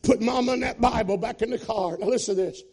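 high, loud, fast-rising revival preaching; preaching at full force. Acted. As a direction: shout the testimony to the rafters.

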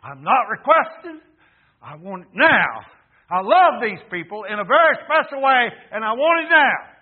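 loud, high insistent demand; commanding. Acted. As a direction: demand it now, no request. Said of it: "It is like command".

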